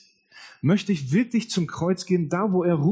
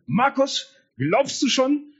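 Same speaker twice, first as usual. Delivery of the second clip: „Markus, glaubst du schon?“ much higher, a gently asking pastor; 10 semitones higher, slower than usual. Retold or imitated. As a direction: play the old pastor with a raised, friendly voice. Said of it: "der damalige Pastor Klaus". (Pastor Klaus fragt deutlich höher und freundlich)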